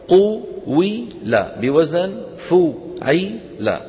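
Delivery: each syllable stretched very slowly; شرح بطيء جداً مقطعاً مقطعاً; slow drawn-out teaching. Shown as a demonstration. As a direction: spell out the pattern slowly for students. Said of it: "فهي قيل أصلها قويلة".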